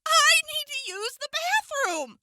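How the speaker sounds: loud, desperate outburst; exasperated shout. Acted. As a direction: yell it, out of patience. Finally blurts out her need.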